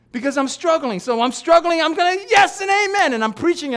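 high, loud impassioned preaching; climbing pulpit yell. Real, not acted. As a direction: push through the struggle at a shout. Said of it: "Why am I yelling more".

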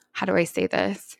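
breathy hushed aside; whisper. Real, not acted. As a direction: drop to a whisper, unsure how to say it. Hesitant aside, half-whispered.

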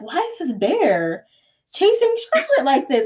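confused. Retold or imitated. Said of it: "I was so conf".

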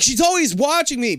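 high mock whine; mimicked childish complaint. Girl griping about her nanny, mimicked in a high voice.

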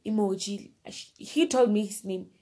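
ordinary talk, nothing marked

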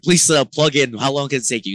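panicked shout on stage; frantic pleading. Retold or imitated. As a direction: hurry him up like the set starts now. Begging late guitarist to hurry.

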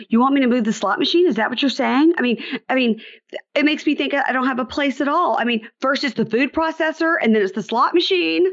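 high, whiny offended mother; wounded and defensive, rising; offended Debbie. Retold or imitated.

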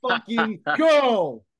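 slow, high, stretched-out cheer; excited. Real, not acted. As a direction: let it rip in celebration. Celebrating the big signing.

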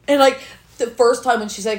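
normal talking voice

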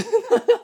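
sudden high burst of laughter; laughing. Real, not acted. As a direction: laugh out loud.

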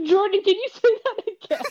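high-pitched words through real laughter; real laughing. Real, not acted. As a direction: ask it while cracking up. Host cracks up at the remark.

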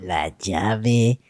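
slow, hushed mysterious announcer; dramatic title echo. Pretend. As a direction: breathe the title like a trailer. Echoes the special episode title.

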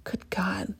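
a whispered aside; amused whisper. Retold or imitated. Reacting to a woman's compliment with an amused whisper. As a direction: breathe it out with a giggle. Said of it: "I just kinda giggle and go".

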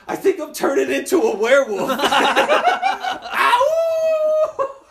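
high, loud laughing werewolf act ending in a howl; mock werewolf. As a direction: ham it up, then howl.

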